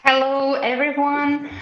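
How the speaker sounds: ordinary talk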